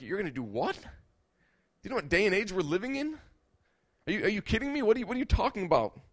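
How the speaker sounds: high, incredulous mocking voice; scoffing disbelief. People scoffing at a vow of purity, incredulous mockery. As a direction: play the scornful crowd, pitch up.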